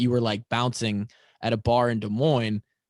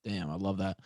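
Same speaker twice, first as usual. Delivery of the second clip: usual speaking voice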